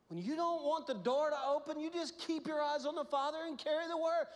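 loud, high, full-force preaching; preaching loudly. Real, not acted. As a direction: drive the refrain home hard. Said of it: "refrain repeated with rising force".

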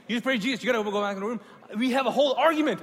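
loud, high, fast insisting; heated insisting. Retold or imitated. Pastor ordering him back to the room, loud fast insisting.

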